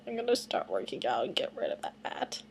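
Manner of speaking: low whispered aside. Real, not acted. Vlogger whispering a joke to herself. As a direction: whisper it to yourself.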